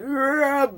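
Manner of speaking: mock bear growl, pitched high; a playful growl like a bear; imitating a bear roar; bear noise. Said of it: "bear noises".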